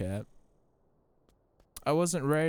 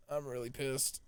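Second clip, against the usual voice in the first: softer, muttered under the breath; quiet grumble; 3 semitones higher, 7 dB quieter, slower than usual. Real, not acted.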